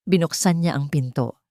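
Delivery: usual speaking voice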